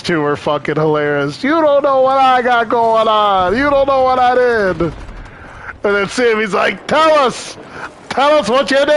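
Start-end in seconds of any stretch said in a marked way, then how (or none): 1.4-4.8 high, loud defiant mobster voice; mock Franzese. Voicing Franzese arguing in interview. 6.9-8.9 mock shouting demand. Voicing Sammy demanding an answer.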